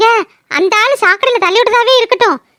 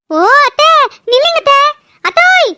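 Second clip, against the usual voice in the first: calling after; 5 semitones higher than usual. Acted. Daughter-in-law calling after her aunt to stop, rising calls. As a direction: chase her with your voice.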